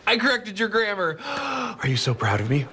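high giddy, pleased; giddy pride. Acted. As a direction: beam with pride. Proud of his grammar.

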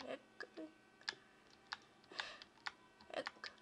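murmured over and over, fading out; fading repetition. Real, not acted. Repeating word, fading to nothing.

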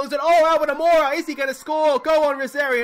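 high, loud, cheering the runner on; willing him round the bases at full voice; excited cheering. Real, not acted. Gamer shouting at the screen as his runner heads home, thrilled and loud.

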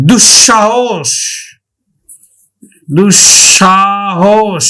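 slow, stretched দুঃসাহস as dush-shahosh; doubled palatal sh. Shown as a demonstration. Teacher showing another example, slow dush-shahosh for দুঃসাহস. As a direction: draw out দুঃসাহস, doubling the middle sh. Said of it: "তালব্য স উচ্চারণ করে থাকি".

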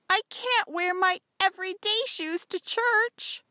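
loud, high, drawn-out complaint; wailing. A narrator's reading. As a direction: wail in protest as the young daughter. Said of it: "I wailed".

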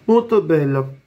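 muffled through fabric; through a face mask. Real, not acted. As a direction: mouth covered by balaclava. Seen 2: Skull-print fabric mask covers mouth and nose throughout the labelled words.

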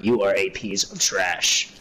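slow and deliberate, every word spelled out; slow, emphatic insult. Real, not acted. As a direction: drag out YOU ARE for emphasis.

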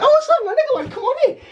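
squeaky falsetto greeting with a laugh; mock nervous welcome. Welcoming friends while she hides, mock nervous welcome. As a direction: overdo the innocent hello, very high.

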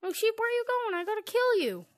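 high, loud playful menace; excited high chase. Real, not acted. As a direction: chase it down gleefully. Chasing sheep, playful menace.

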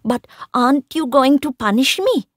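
high, loud desperate plea; a boy urgently begging his stepmother. A narrator's reading. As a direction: beg her to punish him. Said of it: "asked urgently".

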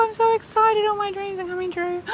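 high, squealing delight; giddy excited affirmation. Pretend. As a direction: squeal it like a dream come true. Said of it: "do a little affirmation".

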